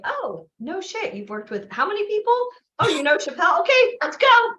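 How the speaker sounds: mock amazement. Hosts reacting to his Chappelle credits, mock excited. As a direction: play it up as starstruck hosts. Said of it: "instead we're like".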